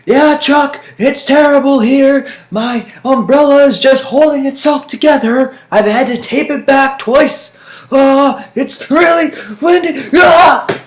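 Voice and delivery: high shouted live report from a gale; storm news reporter. Pretend. Pretend reporter to Chuck about terrible weather, shouting. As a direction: yell to the anchor over howling wind. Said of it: "pretend I was a news reporter".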